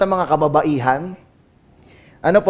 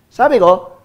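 usual speaking voice

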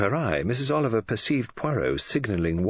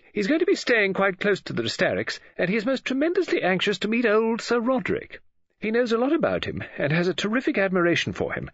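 higher, animated chatter down the telephone; eager gossip, reacting to an unheard caller; gossipy lady novelist; 11 semitones higher, 4 dB louder than usual. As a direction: lively pitch swoops, curious and confiding. Missus Oliver resumes telephone gossip.

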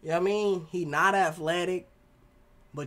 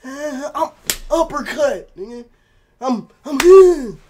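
higher, loud stretched mock threat; mock angry player; 7 semitones higher, 12 dB louder, slower than usual. Imitating the player confronting a fan, hammed-up threat. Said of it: "Fan probably come up to him".

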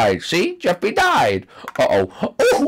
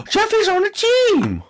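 excited gasp; 14 semitones higher than usual. Acted. Excited discovery.

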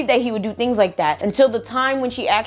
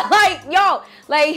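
laughing burst; 9 semitones higher, 4 dB louder, slower than usual. Real, not acted. Laughs at drunk closet story.

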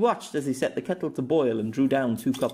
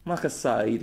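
usual speaking voice

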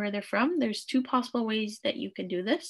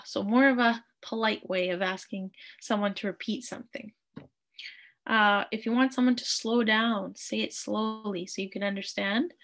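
ordinary delivery

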